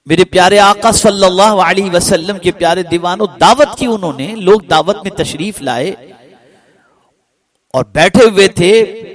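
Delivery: high, loud preaching; fervent sermon address. Acted. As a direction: call out to the faithful with fervour. (پرجوش خطیبانہ پکار)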